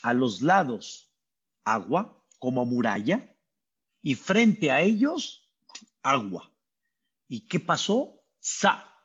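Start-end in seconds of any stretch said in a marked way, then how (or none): none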